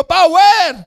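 high, loud cry; shouting. Acted. Someone in a jeepney yelling a slogan, shouting. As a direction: holler it out to the whole jeep. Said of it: "may sumigaw".

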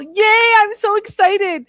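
normal talking voice

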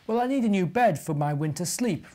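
ordinary delivery, nothing marked

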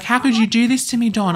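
a wife crying out at her cheating husband; high loud heartbroken plea. Acted. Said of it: "She's gonna be like".

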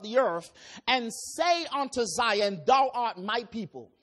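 measured, solemn preaching read; scripture reading. Acted. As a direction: pulpit reading of Isaiah. Reads scripture aloud in sermon.

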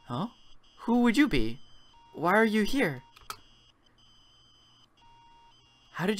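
put-on startled old woman confronting a stranger; mother finds a stranger in her room; confused old mother. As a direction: voice the mother, bewildered and rising. Confused mother voices lines in game.

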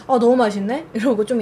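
chewing while talking; talking around food; mouth full. Real, not acted. Seen 0: Picks food from stew by hand, mouth working while talking.

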